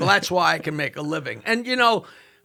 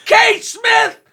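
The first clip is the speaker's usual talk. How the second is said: heckler shout; 5 dB louder than usual. Retold or imitated. Comic off mic shouting the heckle line, high and loud. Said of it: "I'm off mic going".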